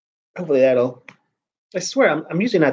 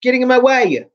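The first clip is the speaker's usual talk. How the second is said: mock yell; 7 semitones higher than usual. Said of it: "I just started yelling at her".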